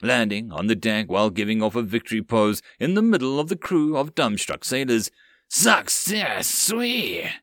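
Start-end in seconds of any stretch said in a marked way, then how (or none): 5.5-7.3 high, gleeful goblin squeal. Acted. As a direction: crow like a happy goblin. Happy goblin landing.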